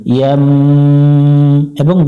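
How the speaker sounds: ইয়াম held with a nasal hum on m; nasal ghunna. Shown as a demonstration. Teacher shows ghunna on ইয়াম. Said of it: "গুণনা করে নিব".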